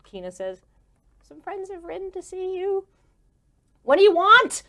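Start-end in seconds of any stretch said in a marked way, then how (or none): 1.2-2.9 high, small announcing voice; timid usher. Acted. Kina announcing visitors to the stressed boss, tentative. 3.8-4.6 high, loud snap; irritated demand. Acted. Stressed manager confronting unexpected visitors, irritated.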